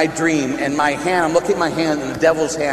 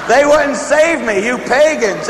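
high, loud, drawn-out comic accusation; mock outrage; 9 semitones higher, 5 dB louder, slower than usual. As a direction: scold the crowd in playful indignation.

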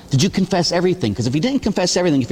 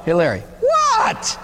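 much higher, slow sing-song hey Larry; drawn-out call; slower than usual. Retold or imitated. Someone calling Larry for no reason, slow high sing-song. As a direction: stretch it out like a nagging child. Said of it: "looking at me and saying".

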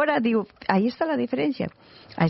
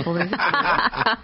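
laughing mock pity; 4 semitones lower, slower than usual. Joking pity for the ball.

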